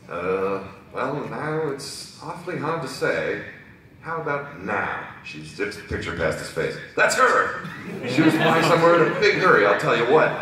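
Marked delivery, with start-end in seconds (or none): 0.1-5.1 put-on slow, hesitant old witness; lightly voiced elderly character, drawn out; elderly pony. Elderly witness unsure of a picture, slow and hesitant. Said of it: "stand with an elderly pony". 6.9-10.3 high, loud burst of certainty; excited elderly pony. Acted. Elderly witness recognizing the suspect, loud and excited. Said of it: "stand with an elderly pony".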